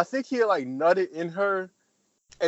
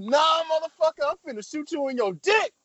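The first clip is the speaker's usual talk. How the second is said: angry shout; 11 semitones higher than usual. Retold or imitated. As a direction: blow up and threaten him. That's when she lost it.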